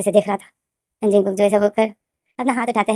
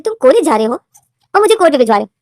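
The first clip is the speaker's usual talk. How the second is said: loud, higher disbelieving outburst; indignant shout; 5 semitones higher, 8 dB louder, faster than usual. Acted. Wayan on phone, outraged at V, shouting. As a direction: throw it back at him, outraged.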